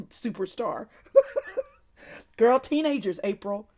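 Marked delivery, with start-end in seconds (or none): 1.1-1.6 a quick, high, loud laugh; short laugh. Real, not acted. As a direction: burst out laughing briefly. Laughing at viewer's comment.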